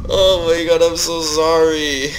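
loud, high apologetic groan; loud dismayed apology. Real, not acted. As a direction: groan out sorry to the viewers. Apologizing to viewers after death.

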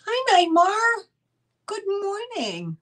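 high sing-song hello to a pet; pet greeting. Real, not acted. Greeting Neymar the pet, high cooing hello.

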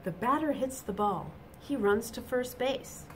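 bright, lively storybook reading; reading to children. A narrator's reading. Teacher reading a picture book to children, animated. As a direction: read it out to the little ones with energy.

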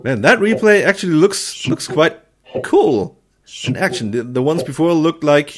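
ordinary talk, nothing marked

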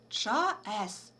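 drawn-out "чаес" read like an ordinary word. Shown as a demonstration. Teacher slowly showing pupils the reading of "чаес", as a word. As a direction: say "чаес" slowly as one word, cha-es, not as letters. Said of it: "і читаємо як звичайне слово".

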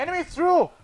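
urgent loud callout; man down shout. Real, not acted. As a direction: shout the warning high. Callout in firefight.

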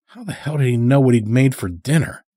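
ordinary delivery, nothing marked

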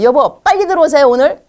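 high, loud urging to come home; pushy call to husband. Shown as a demonstration. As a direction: press him to hurry home. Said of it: "남편한테도". (높고 큰 소리로 재촉)

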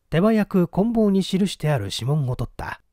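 plain reading voice

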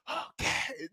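whispered, obedient answer; hushed dutiful response; meek class reply. Retold or imitated. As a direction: imitate cowed pupils agreeing. Whole class repeats meekly.

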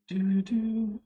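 normal talking voice

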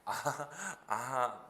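mock sheepish "아, 아"; embarrassed, half-hearted tone. Imitating learners embarrassed outside class, half-hearted tone. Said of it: "민망하니까".

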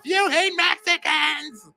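very high, slow mock screech; drawn-out high parody shout; mocking shriek. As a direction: stretch it out as a shrill parody. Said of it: "she's going off".

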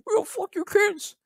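very high, slow put-on troll squeal; mock troll voice. Imitating the troll's message to Crimson in a high, slow squeal. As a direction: stretch it out like a cartoon troll, way up high. That says.

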